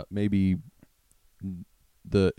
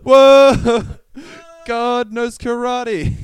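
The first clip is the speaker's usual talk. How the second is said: very high, loud mock awe, then slow; amazed shout; 13 dB louder, much slower than usual. The congregation shouting in amazement that God knows karate. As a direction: cry out in overblown amazement.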